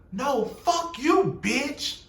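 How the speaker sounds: high, slow, drawn-out "No, fuck you"; slow mock curse. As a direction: stretch it out high and mocking. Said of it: "all I heard Kenya say".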